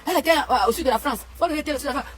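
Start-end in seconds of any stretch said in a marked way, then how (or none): none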